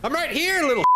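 high teasing call; taunting falsetto. Pretend. Gamer taunting the monster, high teasing voice.

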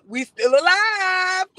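high, loud, slow fake sobbing; mock crying wail. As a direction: ham up a tearful cry of survival. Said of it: "Who Kim said".

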